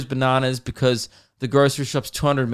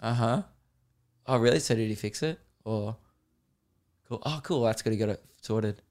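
soft, low-energy filler replies; politely uninterested; 3 semitones lower, 6 dB quieter than usual. Acted. Himself answering a mate's mundane story, underwhelmed and quiet. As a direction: humour him quietly with minimal interest.

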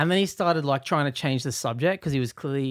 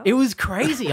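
excited; 9 semitones higher, 4 dB louder than usual. Real, not acted. Excited retelling of bus scene.

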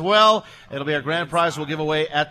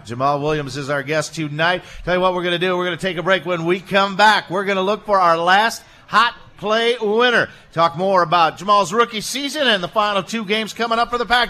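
radio announcer; 5 dB louder than usual. Acted. Host tosses to commercial break.

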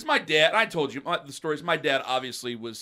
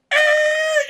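loud, much higher "Eh!" yelled out a car window; sharp yell; 11 dB louder than usual. Retold or imitated. Teen yelling at neighbors from a car. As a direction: bark it out the window.

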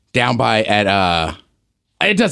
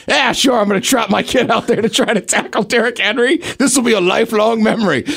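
higher-pitched, lightly voiced eager parent; mock a parent's willingness, pitched up; sarcastic parent; 6 semitones higher than usual. Parent agreeing to let kid tackle Henry, mock eagerness. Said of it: "Do you think parents would be like".

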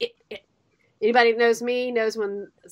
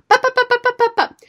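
imitated kids' chatter; 11 semitones higher, 6 dB louder, much faster than usual. Kids chattering at her in car.

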